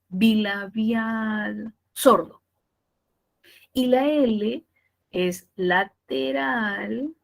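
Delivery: drawn-out, word-by-word dictation; teacher dictating features for notes; dictating slowly. Shown as a demonstration. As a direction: spell out each feature slowly so they can write. Dictating phonetic features to students.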